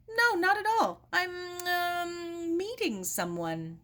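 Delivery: stammer, then steady the fib; flustered girl. Acted. Sarah flustered, lying to doorman.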